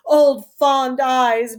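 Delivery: ordinary talk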